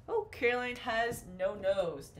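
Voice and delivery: ordinary talk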